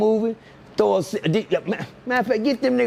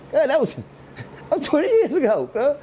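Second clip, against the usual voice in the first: exasperated mock whine; 9 semitones higher, slower than usual. Acted exasperated reply.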